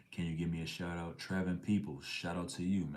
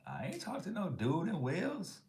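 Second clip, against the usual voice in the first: shocked disbelief; 13 semitones higher than usual. Retold or imitated. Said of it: "but I'm like".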